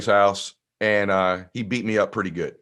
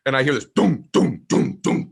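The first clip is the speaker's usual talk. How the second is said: ordinary talk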